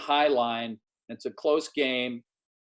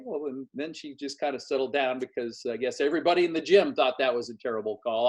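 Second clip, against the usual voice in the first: ordinary talk, nothing marked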